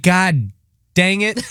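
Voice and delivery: high-pitched, deflated complaint; mock exasperation. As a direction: play up the embarrassed letdown. Acting out his embarrassed letdown.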